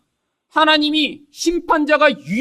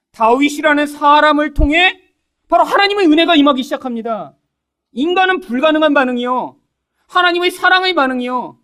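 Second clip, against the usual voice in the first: full-force preaching; 6 semitones higher, 5 dB louder than usual. Acted. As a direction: drive the point home to the congregation. Preaching climax. (힘차게 외치는 설교)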